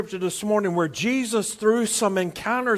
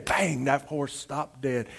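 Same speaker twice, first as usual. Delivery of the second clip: hushed suspense; 8 semitones lower, 11 dB quieter than usual. A narrator's reading. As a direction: drop right down and space it out. Punchline suspense of the horse story.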